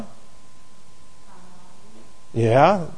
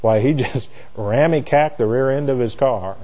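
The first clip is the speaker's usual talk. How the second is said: deadpan mom; 3 semitones lower, 8 dB quieter than usual. Retold or imitated. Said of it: "she would be so dead serious".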